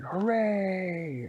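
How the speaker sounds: high, drawn-out hooray; gleeful high cheer; crowd cheer. Acted.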